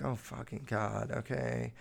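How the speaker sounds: low, soft grumble under the breath; acted-out reluctant giving in; resigned mutter. Pupils' muttered reaction to scolding.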